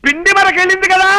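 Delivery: high-pitched calling out, phrase by phrase; strong, exaggerated voice. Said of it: "మామూలుగా చెప్పొచ్చు కదా".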